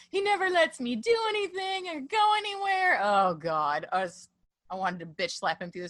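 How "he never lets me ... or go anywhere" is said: high-pitched mocking whine; exaggerated high whiny impersonation; whiny Anakin. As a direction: whine it like a petulant complainer, pitched way up. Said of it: "whiny bitch scene with him and Padma".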